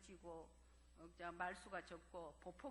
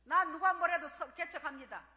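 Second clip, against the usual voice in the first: declaring loudly; 6 semitones higher, 17 dB louder than usual. Retold or imitated. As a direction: proclaim it to the church. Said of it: "선포해버렸죠". (크고 느리게 선포하듯)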